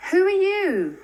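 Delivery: put-on sharp, high and loud girl's challenge; startling stranger's call. Strange girl challenging intruders at the shrine, high and loud. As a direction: call out suddenly from the dark.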